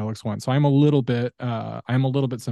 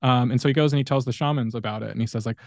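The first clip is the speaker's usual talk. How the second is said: normal talking voice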